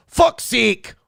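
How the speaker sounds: mildly mock an angry Canadian hoser accent. Streamer mocking an angry fan in Canadian accent. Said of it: "with the Canadian accent".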